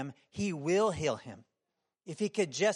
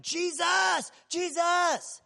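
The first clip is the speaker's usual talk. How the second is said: high, loud call of a desperate blind man; yelling out; 10 semitones higher, 5 dB louder, much slower than usual. Retold or imitated. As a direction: cry out to be heard over a crowd. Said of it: "at the top of his lungs, he starts yelling".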